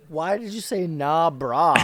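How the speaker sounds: put-on confused old dwarf asking; baffled elder. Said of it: "They're like".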